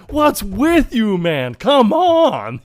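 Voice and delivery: loud, high, stretched-out scolding; hammed-up berating of a fool; mock exasperation. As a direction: cry out in exaggerated disbelief. Acted scolding of gullible opponent.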